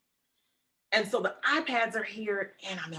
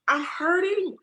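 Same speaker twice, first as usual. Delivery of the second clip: fighting back tears, pitched up; near tears; 11 semitones higher than usual. Real, not acted. Telling her friend about her late father, near tears. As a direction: voice rising high, close to crying. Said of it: "I'm trying not to, to cry right now".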